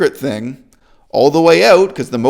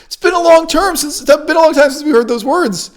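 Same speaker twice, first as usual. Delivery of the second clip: high-pitched words squeezed through laughter; real laughter lifting the whole sentence; laughing hard; 13 semitones higher, 4 dB louder than usual. Real, not acted. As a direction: talk while helplessly laughing. Laughs at the long-abandoned promise.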